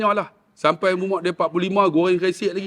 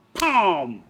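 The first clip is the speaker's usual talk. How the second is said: drawn-out whack with falling pitch; imitated whack. Storyteller imitating father's wire whipping, drawn-out whack. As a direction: voice the lash landing.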